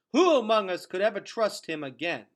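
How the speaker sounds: high, loud shout. A narrator's reading. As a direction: shout it at the crowd. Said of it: "shouted a woman".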